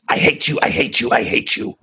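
hushed, breathy spirit hissing hate; a whispery voice of the possessed girl; creepy spirit voice. Acted. Possessed girl hisses at investigator, whispered creepy voice. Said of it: "came out of the mouth of a little girl".

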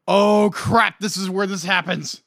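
alarmed. Retold or imitated. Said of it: "I'm like".

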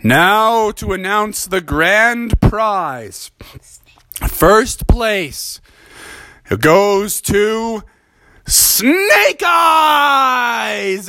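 slow, building to a high shout; principal announcing. Acted. The principal names the grand prize winner, slow then shouted.

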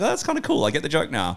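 squeaky aha moment; high sudden realization. Retold or imitated. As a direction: pitch up in realization. Retold own realization.

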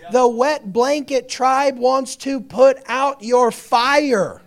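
loud, high, slow pulpit cry; preaching at full force. Real, not acted. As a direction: pound each phrase out to the congregation.

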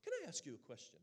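lightly put-on British accent, high opening; a hint of British lilt; imitated British accent. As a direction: greet like a British missionary sister. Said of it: "with her British accent".

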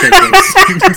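laughing it out, high and loud. Real, not acted. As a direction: burst out laughing on it. Laughing repeat of sponsor joke.

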